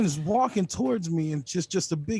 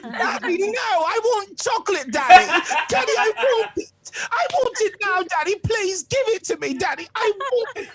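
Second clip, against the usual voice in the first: put-on much higher, whiny bratty little girl begging Daddy; spoiled Willy Wonka girl; 5 dB louder than usual. Comic mimics his girlfriend as the spoiled Wonka girl whining for chocolate. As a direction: wheedle high and spoiled for chocolate like Veruca.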